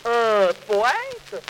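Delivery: startled, drawn-out question; suddenly taken aback; surprised. Acted. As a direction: Saint Peter realizing he faces a poet, caught off guard. Saint Peter surprised, softening.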